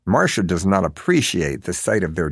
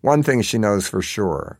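usual speaking voice

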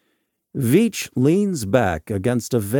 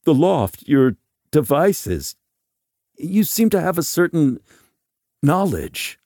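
higher, louder, broken-up plea; stammering; 7 semitones higher, 5 dB louder than usual. A narrator's reading. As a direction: grope for the words with halting gaps. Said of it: "stammers Veach".

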